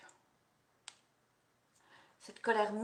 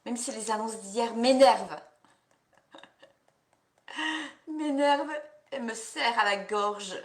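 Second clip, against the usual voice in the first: annoyed outburst; 6 dB louder than usual. Pretend. Anger tapping, venting the annoyance.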